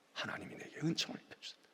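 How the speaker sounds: whispered, fast, in awe; hushed awe. Acted. Preacher voicing Mary, whispered in awe. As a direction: breathe it like a secret blessing.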